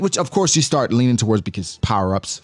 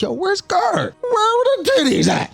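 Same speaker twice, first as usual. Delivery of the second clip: much higher, whiny, craving cry; lovestruck Sanji. Retold or imitated. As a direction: whine for the girls like Sanji. Sanji hunting for women.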